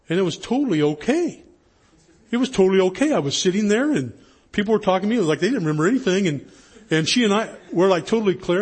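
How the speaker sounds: loud, high, amazed report; excited retelling. Retold or imitated. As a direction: gush the good news, imitating an elated student. Retold student's relieved, amazed report.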